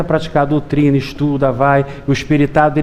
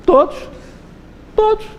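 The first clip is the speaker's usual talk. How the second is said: climbing higher on each todos; insist, rising each time; emphatic repetition. Real, not acted. Pregador enfatiza amor de Deus. (todos, cada vez mais agudo)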